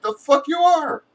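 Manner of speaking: high, sharp mock retort; defiant retort. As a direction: fire it back, pitched high. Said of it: "And I'm like".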